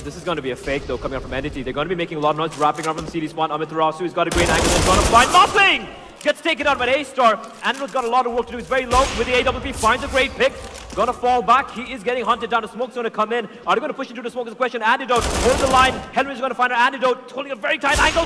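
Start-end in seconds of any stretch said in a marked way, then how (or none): 3.5-15.9 excited play-by-play call; esports caster. Acted. Hyped play-by-play. 16.1-18.2 excited play-by-play call; esports caster. Acted. Hyped play-by-play.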